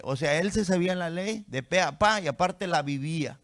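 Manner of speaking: normal talking voice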